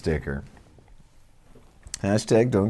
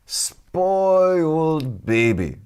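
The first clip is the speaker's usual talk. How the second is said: put-on slow, higher drawled Mexican accent; 7 semitones higher, 4 dB louder, much slower than usual. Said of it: "worst Mexican accent I've ever heard".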